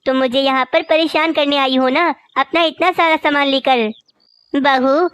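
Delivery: normal talking voice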